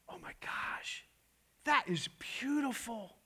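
breathless hushed "Oh, my gosh"; awed whisper. Retold or imitated. Neighbor admiring the new bike, whispered in awe. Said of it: "and he goes".

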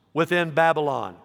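usual speaking voice

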